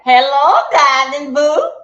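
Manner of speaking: high, loud, drawn-out hello; calling a greeting. Real, not acted. Streamer breaking off a story to greet a viewer, calling a greeting. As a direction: sing out an affectionate greeting, stretched long.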